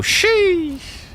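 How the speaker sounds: Sheesh! squeaked way up high; a squeaky, astonished sheesh. Real, not acted. Reacting to a chat message.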